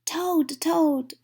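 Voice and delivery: high, loud calls of the name; crying out. A narrator's reading. Frog crying out for sleepy Toad, high and loud. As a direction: call out urgently for a friend. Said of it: "cried Frog".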